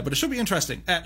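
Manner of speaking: usual speaking voice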